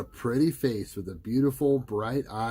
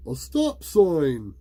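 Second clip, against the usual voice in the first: called out; 7 semitones higher, 7 dB louder, slower than usual. A narrator's reading. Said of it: "Rich called out".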